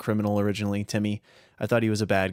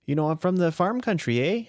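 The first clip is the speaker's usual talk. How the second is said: Minnesota farm country accent, higher; Fargo accent; 7 semitones higher than usual. Shown as a demonstration. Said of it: "I wanted to do the Fargo voice".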